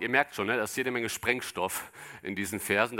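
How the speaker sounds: ordinary delivery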